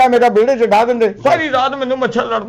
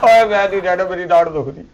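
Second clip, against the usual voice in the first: moaning in toothache pain; 8 semitones lower than usual. Acted. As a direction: whimper about the aching tooth. Toothache after being hit. (ਦਾੜ ਦੇ ਦਰਦ ਨਾਲ ਹੌਲੀ ਹੂੰਗਦਾ)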